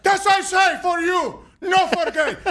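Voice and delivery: put-on high, slow Cuban-accented insistence; hammed-up Cuban accent imitation; Yoel Romero. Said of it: "in this heavy accent".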